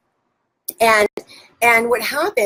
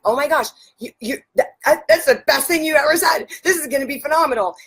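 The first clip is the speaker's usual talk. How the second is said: higher-pitched, thrilled praise; gushing excitement; 6 semitones higher, faster than usual. Retold or imitated. Coach praising her client's decision, excited. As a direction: gush with amazed delight.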